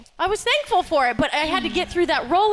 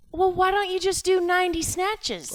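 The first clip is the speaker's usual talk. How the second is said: coach voice; 6 semitones higher than usual. Retold or imitated. Retold coach's suggestion.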